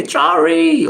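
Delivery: drawn-out, high, loud call of Traore; shouting the name. Shown as a demonstration. Vlogger to viewers, hailing a leader with a long high loud call. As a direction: call Traore out slowly like a cheer. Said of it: "it's like you're shouting the name".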